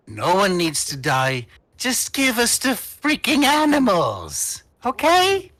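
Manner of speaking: loud, high, wheedling plea; cajoling gnome. Acted. Gnome urges the party to hand over the animals, exasperated pleading. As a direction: reason with them, pitch leaping in exasperation.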